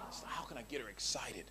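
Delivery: unvoiced conspiratorial whisper. Real, not acted. Preacher confiding his dating tricks, whisper. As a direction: lean in and whisper.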